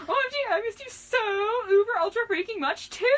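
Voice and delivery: very high, loud, hammed-up gush; gushing squeal. A narrator's reading. Girl answering her missed friend, gushing squeal. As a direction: squeal it right back.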